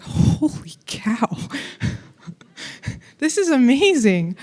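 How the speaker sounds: slow whispered awe, opening into wonder; hushed, drawn-out disbelief with a breathy laugh; acted amazement. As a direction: play a beginner's astonished discovery. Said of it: "you figured out that you could do this and you were amazed".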